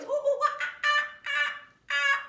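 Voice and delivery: very loud, high monkey screeches; monkey hooting. Acted. Reader forced into monkey noises, loud high hooting. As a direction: whoop and screech like an excited monkey. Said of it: "my monkey voice".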